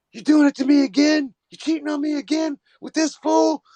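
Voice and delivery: high mock-furious accusation; jealous rant. Jilted man catching his woman in a bar, mock furious. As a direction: squeak out the betrayed lover's outrage.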